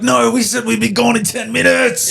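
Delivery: high-pitched mock tantrum; hammed-up, high fussy protest; cranky former self. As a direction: whine it as a cranky complaint. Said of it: "But I used to be like".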